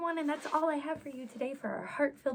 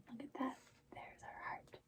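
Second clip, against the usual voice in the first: whisper; 15 dB quieter than usual. Acted. Hushed aside while reading.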